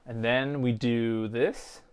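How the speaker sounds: each word stretched, unhurried; slow it right down like a demo; drawn out slowly. Shown as a demonstration. Showing viewers the step, said slowly. Steps through the soldering.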